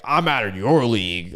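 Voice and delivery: lightly put-on mock comeback; mocking the insulted. Host imitating nice girls and guys who get insulted, mocking. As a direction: imitate an offended person's comeback. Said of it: "Anytime that they get insulted, they're like".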